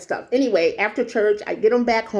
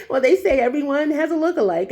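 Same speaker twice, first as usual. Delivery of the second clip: plain reading voice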